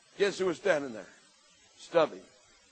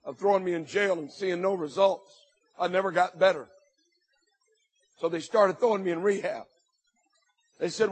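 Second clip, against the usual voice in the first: usual speaking voice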